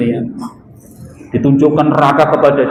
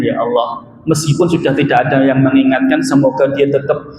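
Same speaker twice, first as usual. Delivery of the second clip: normal talking voice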